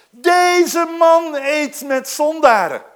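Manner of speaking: loud slow scornful Pharisee; morrende farizeeërs. Acted. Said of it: "hoor de fariseeën". (luide, trage minachting van de farizeeërs)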